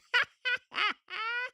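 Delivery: very high staccato cackle; character cackle. Acted. Grandpa character gets away with it, high cackle. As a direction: let out a loud, squealing cartoon laugh.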